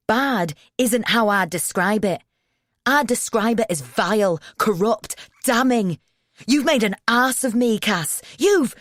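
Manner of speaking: loud, high furious tirade; angry shouting. A narrator's reading. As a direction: shout him down, stumbling with rage. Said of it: "he's shouting and stuttering".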